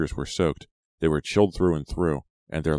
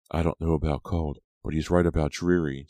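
usual speaking voice

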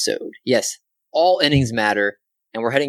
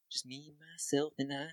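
very soft echo of himself; 2 semitones higher, 19 dB quieter than usual. Pretend. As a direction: let it come back as a faint echo. Soft echo of his own phrase.

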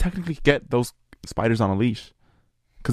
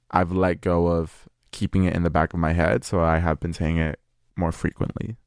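usual speaking voice

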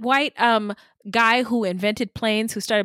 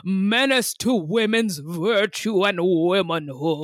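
pious moralizer. Retold or imitated. Voicing prudish moral scolds.

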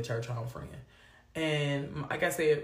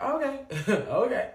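amused God; 6 semitones higher, 3 dB louder than usual. Retold or imitated. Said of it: "God up there like".

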